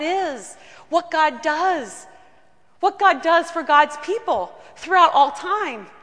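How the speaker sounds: slow, building sermon emphasis; preaching. Acted. Pastor to the congregation, slow preaching that builds. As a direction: preach it, building toward God.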